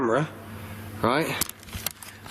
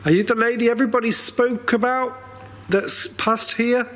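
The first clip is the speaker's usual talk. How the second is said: calling out to spirit; 10 semitones higher than usual. Real, not acted. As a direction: call out to the spirit.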